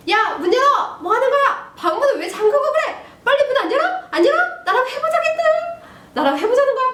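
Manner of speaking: high, loud angry yelling; acted shouting dad. Father pounding on son's door, acted furious shouting. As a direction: yell demands through a locked door. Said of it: "막 자꾸 이렇게 소리를 지릅니다".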